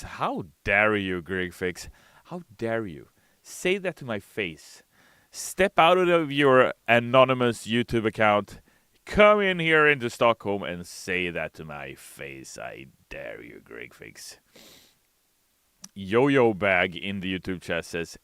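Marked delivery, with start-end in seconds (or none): 0.0-12.6 mock outrage. 12.6-14.4 much lower and softer dare; mock menacing hush. Streamer daring a heckler, low menacing hush.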